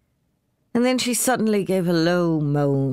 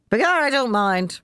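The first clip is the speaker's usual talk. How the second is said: put-on high, merry Irish drunk; lightly voiced cheerful drunk; tipsy Jim; 9 semitones higher than usual. A narrator's reading. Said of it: "replied Jim".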